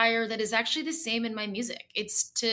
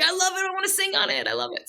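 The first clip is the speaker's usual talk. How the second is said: delighted; 11 semitones higher, 5 dB louder than usual. Retold or imitated. [name] hears the finished song.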